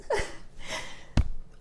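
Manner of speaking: breathy sob through a laugh; tearful laugh-sob. Real, not acted. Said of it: "I'm ugly crying".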